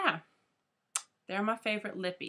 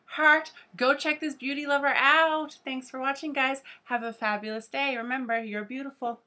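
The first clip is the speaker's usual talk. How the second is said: much higher and loud, bright outro; bubbly sign-off; 7 semitones higher, 6 dB louder than usual. Real, not acted. Vlogger signing off to her viewers, with a bubbly goodbye. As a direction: beam it out to the fans.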